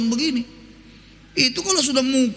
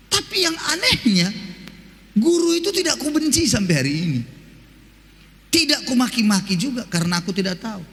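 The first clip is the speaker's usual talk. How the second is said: ordinary talk, nothing marked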